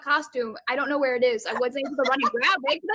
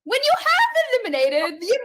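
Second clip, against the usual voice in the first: higher-pitched attempt at British accent; failed British accent; 5 semitones higher than usual. Shown as a demonstration. Contestant shows the accent doesn't work, higher British attempt. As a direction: try British and let it fall apart. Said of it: "watch me try to say that in a British accent".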